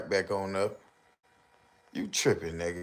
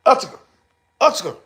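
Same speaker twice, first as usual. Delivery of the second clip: loud high yell; loud high shout; 13 semitones higher, 11 dB louder, much faster than usual. Real, not acted. Yelling the reversed word.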